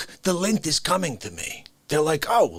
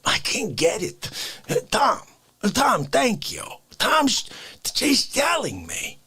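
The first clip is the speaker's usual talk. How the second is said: normal talking voice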